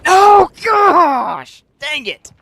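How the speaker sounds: loud frustrated outburst; frustrated shout. Real, not acted. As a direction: yell in frustration at a miss. Frustrated miss in shooter game.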